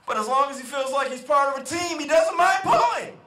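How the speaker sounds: high voice climbing to a loud finish; farmer's rousing, rising reveal; punchline, building. Acted. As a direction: build up to the punchline and belt out the last words. Farmer delivers the parable's punchline.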